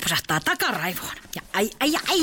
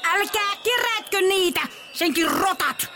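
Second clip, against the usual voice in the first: calling down; 9 semitones higher than usual. Acted. Granny calls down from window.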